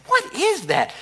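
startled question. Retold or imitated. Hearing a strange ringing noise.

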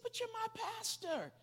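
very high, drawn-out acted protest; reluctant protest. Kid objects to cussing at his pastor, acted reluctant protest. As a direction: plead in a high voice, stretching it out.

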